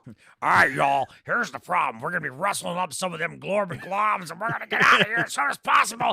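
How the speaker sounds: Nolte as a gruff southern rancher; southern Nick Nolte. Retold or imitated. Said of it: "I wanna hear that voice".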